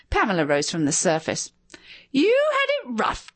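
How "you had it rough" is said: much higher mocking screech at her husband; shrill the words up into a screech. A narrator's reading. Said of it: "she screeched at him".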